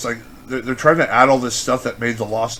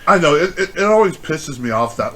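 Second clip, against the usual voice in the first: ordinary delivery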